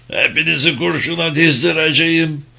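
high-pitched, menacing outburst; angry threat. A narrator's reading. Captured Nazi threatens his attackers.

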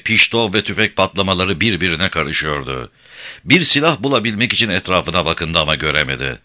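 ordinary delivery, nothing marked

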